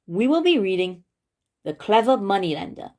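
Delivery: plain reading voice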